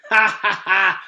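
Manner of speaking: loud jeering ha ha ha; mocking laugh. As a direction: laugh right in his face, high and loud.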